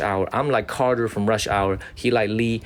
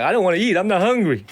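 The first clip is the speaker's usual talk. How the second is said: higher, mock-sulky refusal; teasing impression of his assistant; imitating Harry; 8 semitones higher, 4 dB louder than usual. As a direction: play the picky kid turning food down. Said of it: "He was like".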